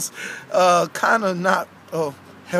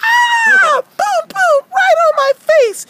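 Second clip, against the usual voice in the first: high-pitched, worked-up character voice; complaining cartoon character; rowdy aggrieved Francis. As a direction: tattle indignantly in a high puppet voice. Francis tells how she was tripped.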